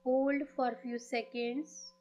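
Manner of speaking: very slow, drawn-out meditation voice; guided meditation. Acted. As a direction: lead the breathing slowly, stretching the words. Leads a breathing meditation. (धीमी, खिंची हुई ध्यान वाली आवाज़)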